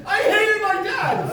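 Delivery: high, slowed squeal of hating dad; mock rebellious girl. A girl eager for a bad boy, high squeal. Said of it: "one to be like".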